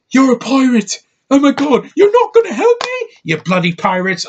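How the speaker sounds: very high, loud mock British screech; mock British accent. Man mocking an actress whose accent gets on his nerves, shrill British impression. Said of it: "She's like".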